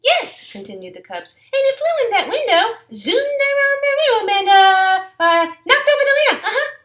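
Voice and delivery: high, loud little-cub voices babbling an excuse; eager child voices telling a fib; excited cubs. Acted. Cubs explain the broken lamp.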